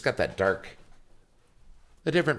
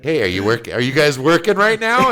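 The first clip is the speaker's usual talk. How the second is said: loud, high imitation of a nosy boss; raised-voice caricature of a boss; mock boss; 6 semitones higher, 9 dB louder than usual. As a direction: call it out like a boss checking on staff. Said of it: "a man that's like".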